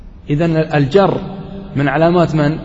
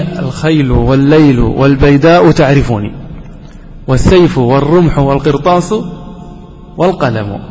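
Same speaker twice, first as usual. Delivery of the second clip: poetry recitation; 3 semitones lower than usual. Shown as a demonstration. Teacher reciting a famous poem to the class, declaimed. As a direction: declaim the Mutanabbi line proudly. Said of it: "قال المتنبي".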